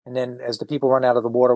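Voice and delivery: normal talking voice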